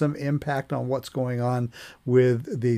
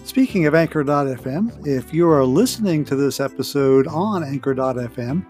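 louder, lifted promo delivery; podcast promo read; 2 semitones higher, 5 dB louder than usual. Acted. Host inviting listeners to send comments, promo read. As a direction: pitch it to listeners with punched key words. Scripted promo over music.

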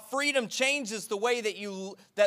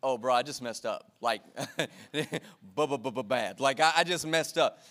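put-on sheepish kid; 9 semitones lower than usual. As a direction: stutter like a caught child. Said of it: "it took him a little while to realize".

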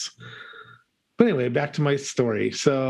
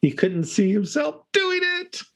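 telling a dad joke. Acted. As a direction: land the pun with a grin.

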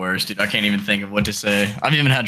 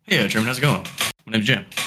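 put-on girlfriend's dad voice. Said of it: "let's run more of a real scenario".

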